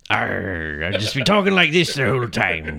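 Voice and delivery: a hint of a pirate growl; lightly voiced pirate talk. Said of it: "give me a little bit of your pirate voice".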